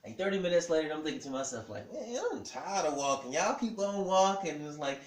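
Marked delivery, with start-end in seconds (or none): 1.9-4.5 high, mock-whiny protest; acted grumble, pitched up and getting louder; fed-up complaint. Husband tired of walking the hospital halls, complaining.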